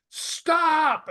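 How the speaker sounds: high sharp shout to halt him; urgent shout. Retold or imitated. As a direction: yell to stop him in time. Someone stops him near shaft.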